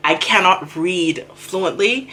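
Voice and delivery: normal talking voice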